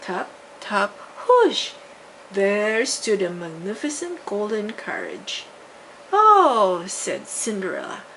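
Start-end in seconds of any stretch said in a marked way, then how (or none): 1.3-1.8 high, loud whoosh of magic. A narrator's reading. Fairy's wand turning the pumpkin, a bright loud whoosh. 6.1-6.8 a loud, high "Oh" of wonder; amazed gasp. A narrator's reading. Cinderella seeing the golden carriage, loud amazed gasp.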